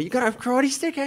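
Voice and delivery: cocky Australian taunt; Aki impression. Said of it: "he's like".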